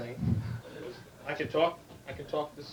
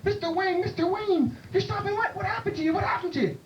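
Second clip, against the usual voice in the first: very high, frantic fan voice; alarmed astonishment; 14 semitones higher than usual. Acted. Bartender to soaked John Wayne, alarmed. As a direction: exclaim in high-pitched alarm.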